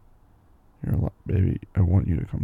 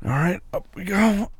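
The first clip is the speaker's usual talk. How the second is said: straining to lift; 10 semitones higher than usual. Real, not acted. Lifting partner up.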